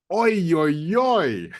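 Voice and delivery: high, excited "Oi, oi, oi" like a Swedish wow. Shown as a demonstration. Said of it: "the way we say, "Oh, wow," is by going".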